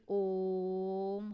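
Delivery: ओम stretched long, held three times as long; drawn-out Om. Shown as a demonstration. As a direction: hold the ओम like a chant. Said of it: "यानी लंबा समय लगता है". (ओम को लंबा खींचकर बोलें)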